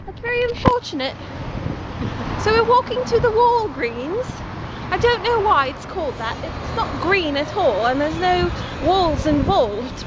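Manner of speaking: lilting mock-British vlogger; playful, genteel British impression; put-on British accent. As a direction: chat away in a polite fake British accent. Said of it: "I'm going to vlog in a British".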